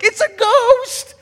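very high, slow shriek of fright; hammed-up panicked disciples; terrified cry. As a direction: scream it like you've seen a ghost.

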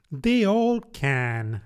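put-on slow, withering old duchess; drawn-out, scornful duchess voice; frail withering duchess.

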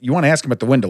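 ordinary delivery

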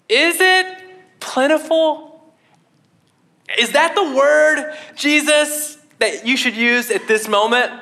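high, drawn-out questioning of the word; sceptical doubter. Retold or imitated. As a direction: pick at the word like a doubtful disciple. Doubter questions Jesus' word choice.

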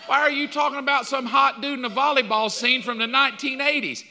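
jealous husband protesting to his wife, indignant. Retold or imitated. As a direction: play the offended husband beside her. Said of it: "I'm looking at her like".

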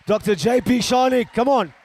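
high, loud shout over applause; rousing introduction. Real, not acted. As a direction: call the guest up with a big cheer.

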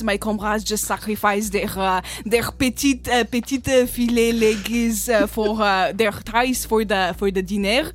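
put-on thick French accent with throaty R's; hesitant, hammed-up French frog chef. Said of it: "is a frog in a French kitchen".

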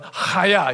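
Haya with a hard throaty h, chopped short; a sharp guttural Haya; guttural judo chop. Shown as a demonstration. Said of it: "It's like a guttural judo chop".

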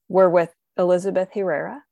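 normal talking voice

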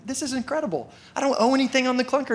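high-pitched giddy excitement; pumped. Acted. Customer walking out debt-free, acting so pumped. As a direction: rush it out high, acting out elation. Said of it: "you're so pumped".

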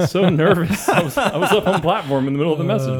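amused self-mockery through laughs; laughing. Real, not acted. Joking laughter over own stumbles.

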